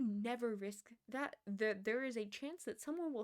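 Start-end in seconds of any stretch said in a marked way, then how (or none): none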